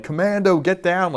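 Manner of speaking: high-pitched imitation of a warning yell; mock scream. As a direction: call out high, as if yelling to a teammate. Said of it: "Everyone's screaming".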